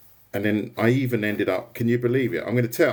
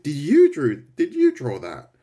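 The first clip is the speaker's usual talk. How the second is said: incredulous; 7 semitones higher, 6 dB louder than usual. Retold or imitated. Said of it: "They said".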